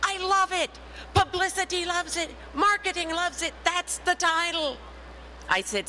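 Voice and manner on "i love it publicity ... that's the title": much higher, gushing with delight; delighted editor. Retold or imitated. Editor gushing to [name] about her title, excited and much higher. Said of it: "And he said".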